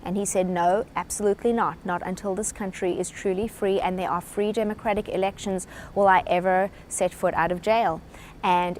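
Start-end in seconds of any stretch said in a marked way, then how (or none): none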